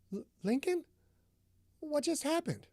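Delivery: high, soft, stammering question; dazed and frightened. A narrator's reading. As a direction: ask him, lost and scared. Delilah wakes confused, voice higher.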